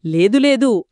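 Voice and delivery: usual speaking voice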